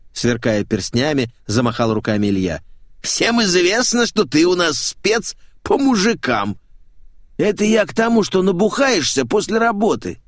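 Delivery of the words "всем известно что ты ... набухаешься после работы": flamboyant showman, placating. Acted. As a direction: explain in a high theatrical voice. Flamboyant man placates the singer.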